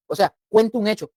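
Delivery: usual speaking voice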